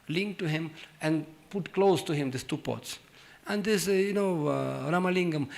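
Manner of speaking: ordinary delivery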